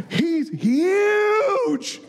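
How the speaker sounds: high, stretched-out cry of awe; hammed-up squeal of amazement; awestruck schoolboy. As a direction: blurt it like a frightened young player. Boy awed by a huge opponent.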